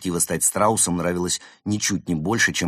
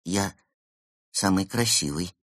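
unsure. A narrator's reading. Penguin repeating the boast without believing it, unsure. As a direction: pause after the first word, then say it doubtfully. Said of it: "неуверенно сказал Евгений".

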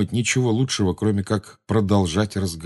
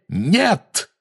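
high-pitched cry of protest; sudden high shout of refusal; urgent cry; 11 semitones higher than usual. Acted. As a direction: cut in to stop the attack. Another character cries out to stop her.

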